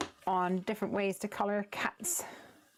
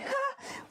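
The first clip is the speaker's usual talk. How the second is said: quick high-pitched laugh; brief self-amused giggle; short laugh. Real, not acted. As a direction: laugh off your own little slip. Laughs at own wonky stamping.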